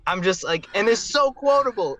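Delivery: ordinary delivery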